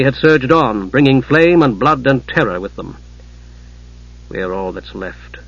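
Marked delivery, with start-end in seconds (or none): 4.3-5.4 low, soft murmur to oneself; said to himself. A narrator's reading. Dazed knight alone after the defeat, said softly to himself. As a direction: voice it low and quiet, as if talking to yourself. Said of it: "Steele said to himself".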